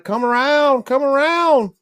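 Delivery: high, thin voice calling from out back; a tiny frail voice beckoning; faint little voice. Acted. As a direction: call it out high like a frail little man hidden round the back. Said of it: "heard a faint little voice".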